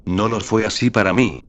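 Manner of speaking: usual speaking voice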